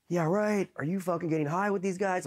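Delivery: high-pitched mock interrogation; imitated angry disbelief. Instructor's disbelieving interrogation acted out.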